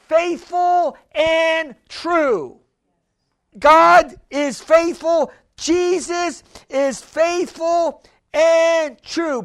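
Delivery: rising, emphatic preacher cadence, slowing down; preaching. Acted. Pastor preaching to the congregation, emphatic and building. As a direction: preach it with conviction.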